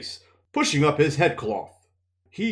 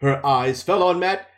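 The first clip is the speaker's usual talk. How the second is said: usual speaking voice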